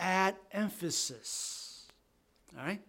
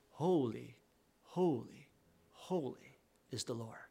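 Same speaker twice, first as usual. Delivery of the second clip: "Holy, holy, holy" each dropping softer; hushed reverent triple; 6 semitones lower, 9 dB quieter than usual. Shown as a demonstration. Preacher showing the triple holy for God, reverent and hushed. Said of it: "Instead, it is".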